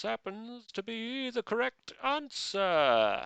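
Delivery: high, slow, grand stage announcement; showman announcer. Pretend. Quizmaster to players, grand showman reveal. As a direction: announce it like a game show host with a big reveal.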